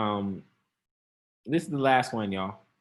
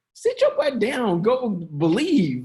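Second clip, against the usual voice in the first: 1 scolding; 6 semitones higher, 5 dB louder, slower than usual. Shown as a demonstration. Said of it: "how it translated".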